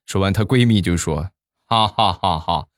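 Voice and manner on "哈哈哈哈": gloating laugh. Retold or imitated. Friend gloats over prank.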